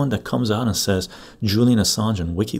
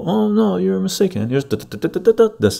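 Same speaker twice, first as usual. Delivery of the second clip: high, sing-song know-it-all correction, mildly hammed up; lightly mocking, much higher, slightly louder; mock correcting; 11 semitones higher, 3 dB louder than usual. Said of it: "And you go".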